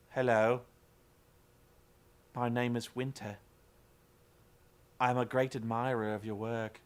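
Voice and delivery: low, flat, alien-like monotone; monotone robot. Retold or imitated. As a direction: imitate her robotic delivery with no inflection. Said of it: "she was talking like a robot".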